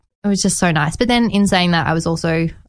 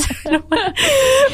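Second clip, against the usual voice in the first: a burst of high giggling; high squealing laugh. Real, not acted. Laughs after the quote.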